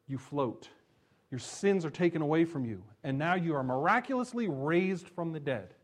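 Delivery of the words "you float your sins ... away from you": usual speaking voice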